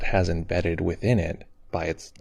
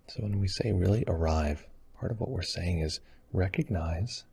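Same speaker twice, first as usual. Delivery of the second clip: guided meditation; 4 dB quieter than usual. Acted. Teacher leading meditators to arrive in this moment, guided meditation. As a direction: guide them gently, phrase by phrase.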